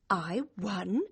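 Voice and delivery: tentative, hushed realisation; stunned disbelief. Acted. Girl takes in that she won, stunned disbelief. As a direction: test the words as if unsure.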